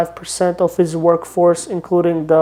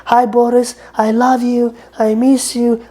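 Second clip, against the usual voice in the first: higher, lightly voiced Yugoslavian cleaning lady; Yugoslavian accent; 5 semitones higher than usual. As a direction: imitate the cleaning lady's broken English, pitched up. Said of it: "she always talks to me with an accent".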